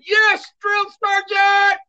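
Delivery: recruit shouting agreement to the sergeant; recruit's shout. Retold or imitated.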